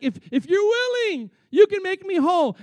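a high, imploring mock-leper; pleading leper. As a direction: beg for healing from up high. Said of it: "And he's like".

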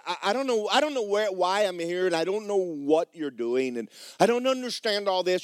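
overwhelmed and pleading; flustered protest. Acted. Bewildered girl to the castle staff, high flustered pleading.

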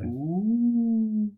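high ghostly ooh; ghost wail. Pretend. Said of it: "can you do a ghost?".

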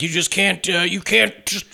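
retold mad woman. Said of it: "she starts getting really mad".

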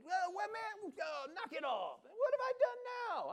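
very high, whining protest; high whiny little brother. Retold or imitated. David protesting to Eliab in a high whine. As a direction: whine like a wronged kid. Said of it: "And then".

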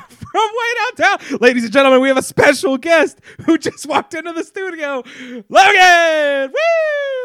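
loud, high hype announcer. Acted. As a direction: announce him like a ring host. Announcing a guest arriving.

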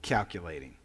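low, flat machine-like calculating; a hint of a robot voice; lightly voiced phone assistant; imitating Siri. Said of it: "And then she said".

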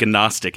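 "gnostic" with the G sounded, guh-NOS-tic. Real, not acted. Podcaster grumbling about a colleague's insult, "gnostic" said guh-nostic. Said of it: "Is that not how you pronounce that?".

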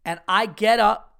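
normal talking voice

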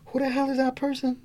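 high, bewildered who-the-hell; baffled. Retold or imitated. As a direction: act like you never heard the name. Not recognising own first name.

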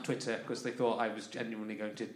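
ordinary talk

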